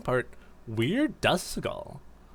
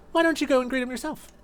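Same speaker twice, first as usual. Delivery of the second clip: much higher playful sing-song; teasing Espeon; 13 semitones higher, faster than usual. Acted. Espeon nudging Duskull to greet rescuers, playful high voice. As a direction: coax him with a sly lilt.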